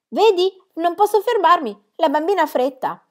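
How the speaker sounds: high, loud call in passing; hurried excuse. A narrator's reading. Aunt hurrying past greeting villagers, calling out excuses. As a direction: call it out without stopping.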